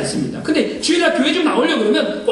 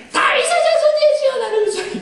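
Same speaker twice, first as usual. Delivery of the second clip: a much higher, pleading call; desperate cry out; 12 semitones higher than usual. Retold or imitated. Blind beggar crying out to Jesus over the crowd, high pleading call. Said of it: "그보다 더 큰 소리로 얘기한 거예요".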